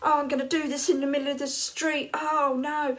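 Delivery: hammed-up desperate moan; panicky wail. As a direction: wail that you won't make it in time. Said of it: "there's me going".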